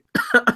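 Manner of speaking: sudden hacking cough. Real, not acted. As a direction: cough it out mid-sentence. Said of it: "I'm choking up".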